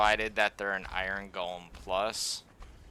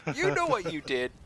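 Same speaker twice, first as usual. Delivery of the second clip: higher, mock accusing line; 8 semitones higher than usual. Thrall accusing the player, higher and mock stern. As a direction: lay the blame on with a knowing tone. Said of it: "It's just like".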